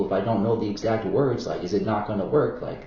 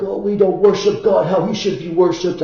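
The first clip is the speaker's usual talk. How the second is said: imitating believers, higher and louder; a put-on sanctimonious complaint, pitched up; mock pious voice; 8 semitones higher, 6 dB louder than usual. Mocking how Muslims see others' worship, in a high, loud put-on voice. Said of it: "that they're like".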